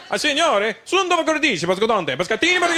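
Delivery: high-pitched gibberish Italian; mock Italian. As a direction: babble excitedly in fake Italian. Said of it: "started speaking Italian to me".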